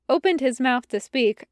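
usual speaking voice

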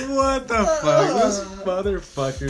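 slow, high, laughing what the fuck; laughing disbelief. Retold or imitated. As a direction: laugh while asking it. Staff react, laughing.

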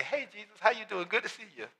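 put-on soft, offhand hello to an old friend; breezy mock greeting, quietly; casual greeting. Sisters pretending all is fine, soft casual hello. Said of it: "they probably said something like".

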